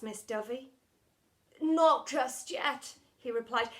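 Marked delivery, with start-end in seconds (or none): 1.6-3.0 slow, louder and lifted; earnest suitor. Acted. Mister Lovey kneeling before Miss Dovey, slow earnest reply. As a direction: hold her off gently, slowly.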